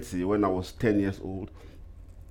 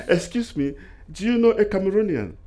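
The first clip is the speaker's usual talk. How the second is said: put-on high, eager Cameroonian accent; thick Cameroonian accent; 10 semitones higher, 3 dB louder than usual. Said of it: "with my thick Cameroonian accent".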